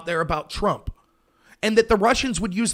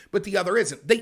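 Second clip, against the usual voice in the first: ordinary talk, nothing marked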